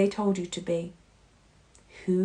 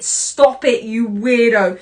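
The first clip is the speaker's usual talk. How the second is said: mocking scold; 6 semitones higher, 12 dB louder than usual. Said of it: "Toad, and Bob clawed at it".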